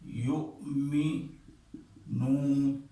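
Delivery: یؤمنون drawn out slowly in recitation; slow Quranic recitation of یؤمنون; recited Quranic word. Shown as a demonstration. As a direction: recite یؤمنون with a long madd. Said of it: "ایک مثال میں لکھتا ہوں یؤمنون".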